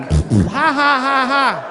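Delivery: high imitated "ha ha ha" of a woman laughing; imitating a girlfriend laughing at him; mock laughing. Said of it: "and she's laughing".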